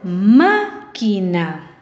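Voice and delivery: "Máquina" very slow, MÁ drawn out and punched; stress on first syllable. Shown as a demonstration. Teacher repeats to show the stress, "Máquina" very slow on MÁ. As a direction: stretch MÁ in "Máquina", then separate syllables falling away. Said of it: "¿Dónde lleva la fuerza de voz?".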